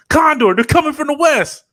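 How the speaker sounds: loud, high dramatic warning; cartoon soldier shout. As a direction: yell the warning like a cartoon sidekick. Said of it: "who just comes up like".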